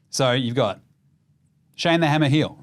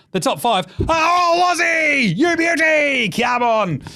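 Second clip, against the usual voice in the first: excited cheer; 11 semitones higher, slower than usual. Real, not acted. Host cheering that the top five are all Aussie, shouting excitedly. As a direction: yell it out for the Aussies.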